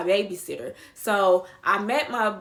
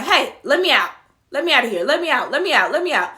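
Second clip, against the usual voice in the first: loud, punched repeated demand; urgent demand; 3 semitones higher, 6 dB louder, faster than usual. Retold or imitated. Passenger demanding out of the car, urgently. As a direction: insist on it, higher and louder each burst.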